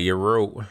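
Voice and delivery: "Euro" with a lengthened r; drawn-out Euro. Shown as a demonstration. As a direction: linger on the r of "Euro". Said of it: "I'ma say it like that".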